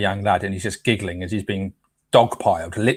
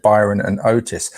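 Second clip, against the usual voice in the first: normal talking voice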